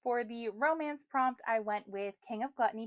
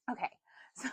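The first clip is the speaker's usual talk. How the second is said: whispered laugh; 6 dB quieter, much faster than usual. Real, not acted. Whispered laugh before restarting.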